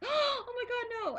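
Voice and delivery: high, slow, fearful oh my god; horrified no. Retold or imitated. Fan watching Jeremy nearly die, horrified. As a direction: plead in horror. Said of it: "I was kinda like".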